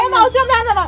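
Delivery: high-pitched, heated refusal; upset refusal. Real, not acted. Girlfriend refusing in a quarrel over a prank, upset and high. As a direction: refuse sharply with the pitch thrown up.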